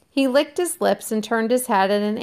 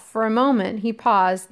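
plain reading voice

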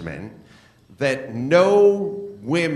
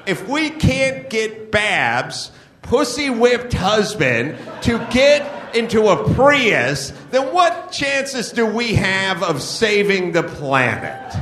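ranting shout; 5 semitones higher than usual. Real, not acted. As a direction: shout it out in disbelief. Ranting about Babs' husband.

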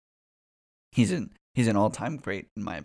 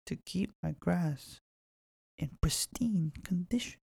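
smug quiet finish; 5 semitones higher, 4 dB quieter than usual. Retold or imitated. Scolding quote ends softly.

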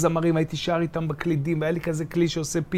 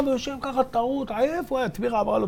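ordinary talk, nothing marked

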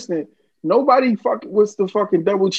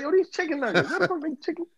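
higher, hushed mock wonder; amazed kid; 8 semitones higher, 7 dB quieter than usual. Storyteller voicing his young self marvelling at nuggets. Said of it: "he was like".

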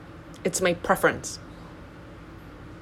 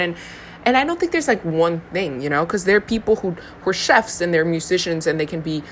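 normal talking voice

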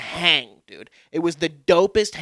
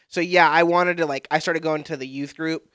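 ordinary talk

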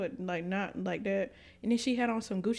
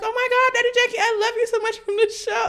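starstruck fan; 10 dB louder than usual. Retold or imitated.

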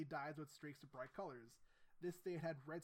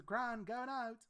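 put-on higher, louder British girl calling out; British accent; 6 semitones higher, 7 dB louder, slower than usual. [name] calls to her gran, mock British accent. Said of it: "British, we did it".

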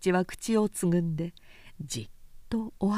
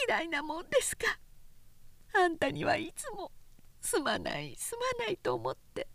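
high, pleading woman's voice fading to softer; tipsy Oaki; 9 semitones higher, 3 dB quieter than usual. A narrator's reading. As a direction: drunk woman letting him down gently. Drunk woman voiced in story.

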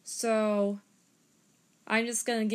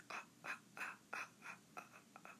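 soft unvoiced breathy ah pulses; breathy throat pulses; 23 dB quieter, much faster than usual. Shown as a demonstration. Harmonica teacher demonstrating throat pulses, soft breathy huffs. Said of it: "I just say".

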